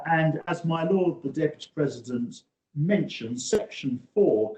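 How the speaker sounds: ordinary delivery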